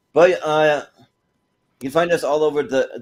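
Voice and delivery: normal talking voice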